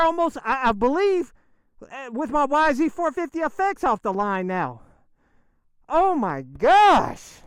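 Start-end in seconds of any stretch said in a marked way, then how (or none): 5.9-7.4 slow, loud, drawn-out amazement; awed oh my gosh. Real, not acted. Rider stunned by the modded bike's pull, slow loud awe. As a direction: stretch it out in awe.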